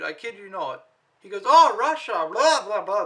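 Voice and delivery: normal talking voice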